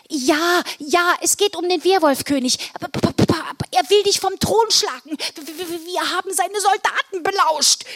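high, stammering urgent warning; agitated calling out. Acted. Elf warns Santa about the Werwolf king, agitated. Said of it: "rief ich".

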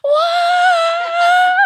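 high drawn-out crowd whoa; a crowd cheering wildly; crowd roar. Retold or imitated. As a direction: mimic a stadium crowd roaring. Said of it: "They were like".

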